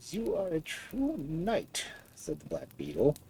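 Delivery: put-on an old creature taking its time; old beetle voice. A narrator's reading. Beetle praises prince.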